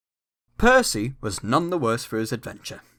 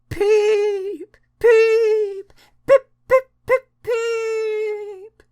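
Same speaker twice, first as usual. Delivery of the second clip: much higher, piercing whistle shrieks; ghostly shrieking whistle; 6 dB louder, much slower than usual. A narrator's reading. Percy scaring Thomas in the shed, shrieking whistle. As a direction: shriek like a ghost engine in the dark. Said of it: "he shrieked".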